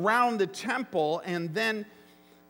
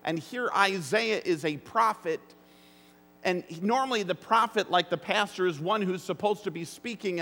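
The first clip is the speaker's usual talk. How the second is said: ordinary delivery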